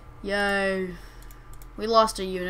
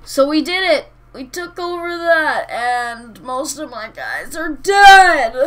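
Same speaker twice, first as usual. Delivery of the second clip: loud, crying-out cheer over the win; excited victory shout; 3 semitones higher, 11 dB louder than usual. Real, not acted. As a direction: yell it out, thrilled and half-despairing. Celebrating a costly battle win.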